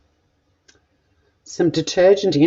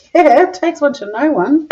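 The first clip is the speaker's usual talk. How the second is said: cheeky comeback; 10 semitones higher than usual. Retold or imitated. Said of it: "I was like".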